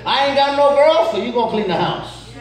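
a stern mother handing out chores; mother laying down the law. Retold or imitated. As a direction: rattle off the chores like a strict mom. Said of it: "She said".

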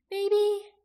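put-on high, thin, drawn-taut girl's voice; thinned-out voice. A narrator's reading. Aki answering Jaqen under strain, high thinned-out voice. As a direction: pitch it far up and thin. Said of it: "Aki's voice was thinned out".

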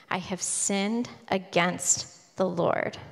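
"I have sinned against the Lord" drawn out slowly and softly; slow solemn repeat. Retold or imitated. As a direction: let each word of the confession land, slowly. Confession repeated, slowed.

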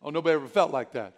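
normal talking voice

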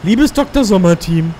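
lightly voiced worried teenager; mock teen letter voice. Reading a teen magazine letter.

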